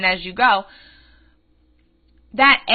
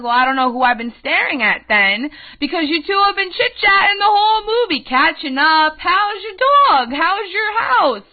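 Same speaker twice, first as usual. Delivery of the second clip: higher and louder, sarcastic chit-chat mimicry; mock their small talk with a sneer; indignant retort; 6 semitones higher, 4 dB louder than usual. Young man scolding chatty women, sarcastic and loud.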